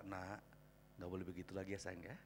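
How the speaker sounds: hushed loving reproof; gentle parent. Acted. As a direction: softly coax a child to behave. Gentle parent correcting a child.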